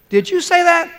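high, loud, startled cry; startled shout. Acted. Burglar startled by a talking parrot, high loud shout. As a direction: jump at the bird with a shocked yelp.

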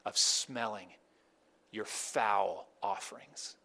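low, slow, disgusted; disgusted God. Retold or imitated. God rejecting the offerings, low and disgusted. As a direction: voice God's weary disgust, low and drawn out. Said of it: "which is like".

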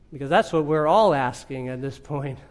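ordinary delivery

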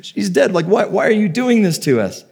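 high-pitched mock disbelief, no real laugh; imitating distraught people who can't believe it; incredulous mourners. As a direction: act out upset, scoffing disbelief. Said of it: "they just start laughing".

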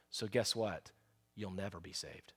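preacher warning the lost, soft and grave; hushed warning. Real, not acted. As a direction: drop low to let it sink in. Quiet warning to congregation.

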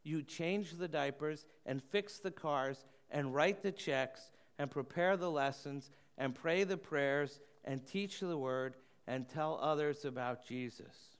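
ordinary delivery

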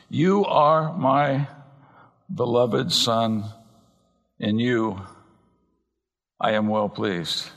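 very slow, phrase by phrase with long pauses; solemn Father's address. Retold or imitated. God the Father speaking to his Son, slow and deliberate. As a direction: draw out "you are my", pausing before each next phrase. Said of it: "God the Father speaking to the Son".

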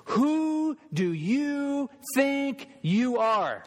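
very high, slow, drawn-out sneer; accusing Pharisee. Retold or imitated. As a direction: jab a bony finger and sneer each word. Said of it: "bony fingers in Jesus' face, they say this".

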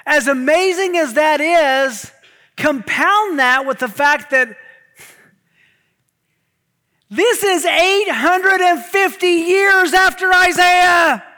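loud, high, driving sermon voice; full-force preaching. Acted. Pastor building excitement about heaven's throne, preaching loud. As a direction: preach it to the back row.

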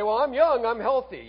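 loud, high mock protest; cocky objector. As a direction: imitate a breezy, self-assured objection. Voices a confident objector.

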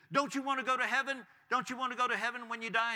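high, insistent imitated evangelist's plea. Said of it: "the guy goes up to him and he said".

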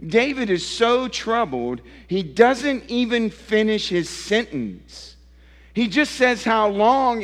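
high, pressing preacher's build; impassioned preaching. Acted. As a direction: drive it home to the congregation.

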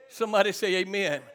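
normal talking voice

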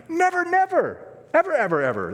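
insistent preacher's emphasis on every "never" and "ever"; emphatic never. Shown as a demonstration. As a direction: pound home "Never, never. Ever, ever, ever.". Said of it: "the emphasis is like that in the Greek".

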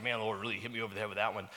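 very fast, mock-casual throwaway; goofy quote. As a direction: toss it off like a goofy cliché. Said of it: "we say goofy things like".

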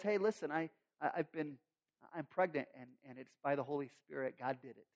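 soft, low, hesitant; hesitant confession. Acted. Fiancee nervously confessing pregnancy, soft and low.

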